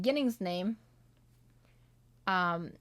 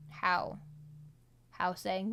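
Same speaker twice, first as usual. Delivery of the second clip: normal talking voice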